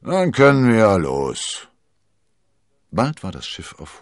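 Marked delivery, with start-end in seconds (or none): 0.0-1.7 loud, slow grumbled go-ahead; drawn-out, raised captain's voice; gruff captain. A narrator's reading. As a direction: announce departure like a grudging skipper. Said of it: "brummte der Kapitän".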